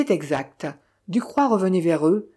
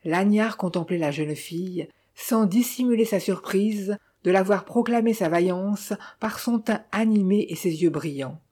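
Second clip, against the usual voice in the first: ordinary delivery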